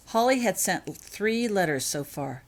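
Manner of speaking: ordinary delivery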